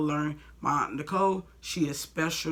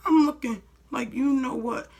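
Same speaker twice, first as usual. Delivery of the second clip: outraged; 9 semitones higher, 4 dB louder than usual. Real, not acted. Furious at selfish manager.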